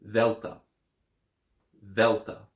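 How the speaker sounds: Delta begun with a voiced th as in this, THEL-tah; soft th Delta. Shown as a demonstration. Teacher modelling Delta with voiced th. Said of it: "with the T H sound as in this".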